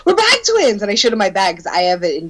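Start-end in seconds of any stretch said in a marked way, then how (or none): 0.1-0.8 high-pitched delighted announcement; excited retelling. Retold or imitated. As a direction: imitate squealy excitement over a shared find. Retells thrilled discovery to stranger.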